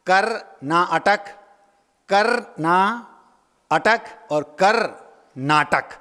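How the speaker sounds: "कर ना अटक" and "कर नाटक" said very slowly, word by word; slow, spaced-out "कर ना अटक"; drawn-out "कर नाटक", pausing between parts; slow split. Shown as a demonstration. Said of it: "शब्द है एक कर्नाटक".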